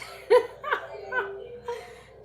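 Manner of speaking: high breathless laugh; real laughter. Real, not acted. Streamer bursting into laughter again over the stream delay. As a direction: another helpless burst of laughter. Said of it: "This is so funny".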